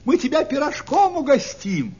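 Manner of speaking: high-pitched, carrying storybook shout; calling from shore. A narrator's reading. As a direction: call out across the water, stretching the hail. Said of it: "ребята кричат ей с берега".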